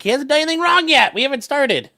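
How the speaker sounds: high, loud mock scold to the dog; playful high scold. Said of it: "I'm like".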